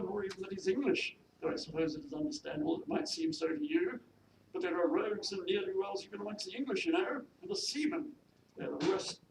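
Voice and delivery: haughty, high-pitched pronouncements; disapproving mistress. Acted. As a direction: lay down the law as a snobbish lady. Mistress scolds and warns maid.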